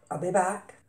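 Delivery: normal talking voice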